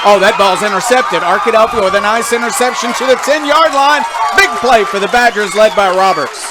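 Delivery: loud, high excited play call. Real, not acted. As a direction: call the interception with a jump in energy.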